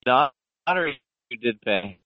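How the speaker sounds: normal talking voice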